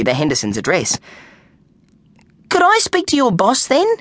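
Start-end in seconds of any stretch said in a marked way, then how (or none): 2.5-3.9 put-on young boy, much higher, unsure; hesitant kid asking a stranger; boy's voice. A narrator's reading.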